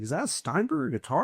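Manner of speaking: imitating a fellow guitar nerd lighting up; excited question. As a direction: ask it like you can't believe your eyes. Guest spots the guitar, amazed.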